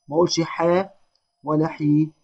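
ordinary talk, nothing marked

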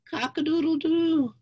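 high, sing-song cock-a-doodle-doo; rooster crow. Acted. Poet giving the password in a poem, high rooster crow.

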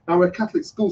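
ordinary talk, nothing marked

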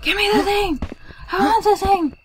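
put-on high, loud whiny kid voice; childish whining demand. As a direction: whine it like a greedy child.

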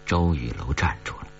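usual speaking voice

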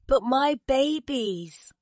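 high, slow, plaintive cry. A narrator's reading. Mother bird over her babies, high plaintive cry. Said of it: "cried the mother bird".

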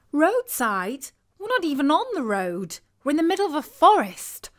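exasperated outburst; exclaiming. Acted. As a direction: burst out in disbelief. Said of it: "exclaimed his normally calm girlfriend".

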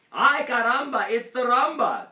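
very high, loud whoop of delight; excited cheer. A narrator's reading. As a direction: cheer it out at full volume. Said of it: "cheered the Chimichangos".

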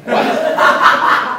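normal talking voice

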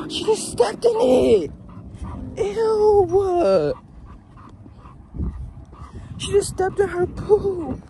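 high, drawn-out squeal of dismay; disgusted shriek. Real, not acted. Owner watching the dog step in her poop, disgusted shriek. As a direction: wail it high, stretching the words.

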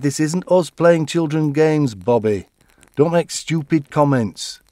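loud, slow, scolding brother; stern scolding. Retold or imitated. Brother scolding Bobby for his comment, loud and slow. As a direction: put him in his place.

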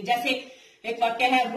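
normal talking voice

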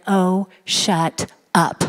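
low, slow, deadpan put-down; curt divine dismissal. Retold or imitated. The Lord answers the man who goes on and on, deadpan dismissal. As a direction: space out each word, low and unimpressed. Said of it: "the Lord responded to the man and said".

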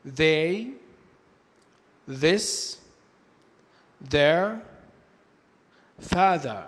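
"they", "this", "their", "father" with th like Arabic ذال; voiced th. Shown as a demonstration. Teacher giving examples of dhal sound, "they", "this", "their", "father" with voiced th. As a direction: model each word separately, "they", "this", "their", "father", with a voiced th. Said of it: "زي حرف الذال في اللغة العربية".

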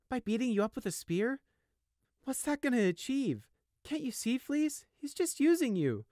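high, urgent pleading; pleading little brother. Acted. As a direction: try to break through to him. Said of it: "trying to break through".

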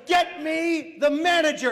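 loud, high, slow order; angry demand. Acted. Dad demands the manager from desk lady, loud slow demand. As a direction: shout it with each word weighted.

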